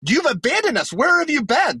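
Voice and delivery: high, indignant rant at an absent God; exasperated Gideon. Pretend. Gideon complaining to God about oppression, high and exasperated. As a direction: whine it up high, fed up and accusing. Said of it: "I'd be like".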